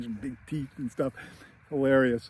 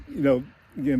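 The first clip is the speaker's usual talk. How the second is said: normal talking voice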